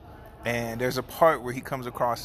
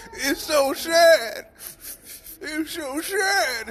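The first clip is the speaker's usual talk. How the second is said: much higher, slow sobbing voice; drawn-out imitation of a brother sobbing; mock crying; 5 dB louder, much slower than usual. Brother crying at a sad movie scene, high slow mock sob. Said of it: "and just get to crying".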